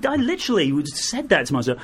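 normal talking voice

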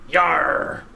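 growled pirate exclamation. Pretend. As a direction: bark it like a pirate. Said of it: "Talk Like a Pirate Day".

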